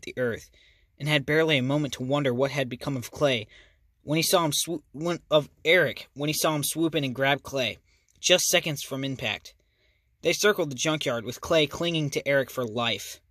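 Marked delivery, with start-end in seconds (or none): none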